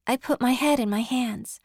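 usual speaking voice

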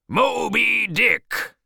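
high, loud, long-drawn shout. Acted. Captain hearing the whale named, shouting it out. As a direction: stretch the name in a great cry. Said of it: "shouted Ahab".

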